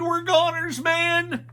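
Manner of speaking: high, loud whiny wail; despairing Shaggy. A narrator's reading. Shaggy giving up to the monsters, high and loud. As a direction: wail it like all is lost.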